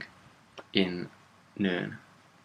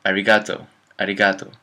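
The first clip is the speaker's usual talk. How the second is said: arigato with English stress, louder, a-ri-GAH-tow; foreign accent; 6 dB louder, faster than usual. Retold or imitated. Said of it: "many foreign people say".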